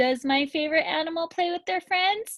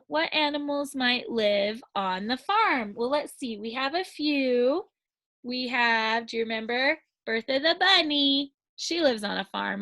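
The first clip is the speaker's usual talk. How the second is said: usual speaking voice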